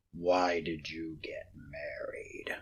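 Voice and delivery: low, hushed, scornful question; contemptuous whisper. Acted. Scrooge scorning his nephew's marriage, hushed and low.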